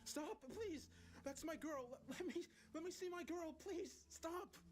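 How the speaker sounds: frantic, very high-pitched pleading; inconsolable. A narrator's reading. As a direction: beg and shout while being held back. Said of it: "I was inconsolable".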